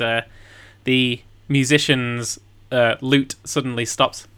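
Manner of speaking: ordinary talk, nothing marked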